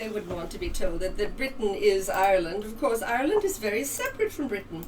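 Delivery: a hint of posh English accent, soft; lightly put-on posh English accent as [name]. [name], back from the Queen, speaks in a light posh English accent. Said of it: "she's picked up a more posh accent".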